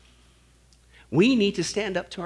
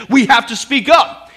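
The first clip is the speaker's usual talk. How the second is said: louder, climbing call to speak up; rising preacher call; 7 semitones higher, 11 dB louder than usual. Real, not acted. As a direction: press the congregation to speak out. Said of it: "preacher urging congregation".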